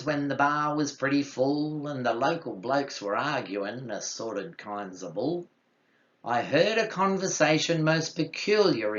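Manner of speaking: rhythmic Aussie ballad recital; poem recitation. Acted. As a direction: recite it like a yarn at the pub, with a bouncing rhyme.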